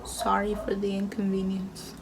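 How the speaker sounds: soft unvoiced whisper of "Sorry for the inconvenience"; whispered sign reading. Reading the sign's apology to viewers, whispered.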